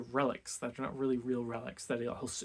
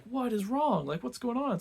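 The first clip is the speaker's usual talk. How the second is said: high-pitched concerned husband; imitated startled concern, pitched well up; mock alarm; 10 semitones higher, 3 dB louder than usual. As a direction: lightly act out a worried "what's wrong?". Husband finds wife upset, asks why.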